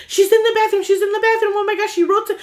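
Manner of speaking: high-pitched frantic babble; retold freaking out. Roommate telling the aunt about the bathroom, frantic and high. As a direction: re-enact a panicked, breathless-to-explain outpouring. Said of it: "like, I'm freaking out".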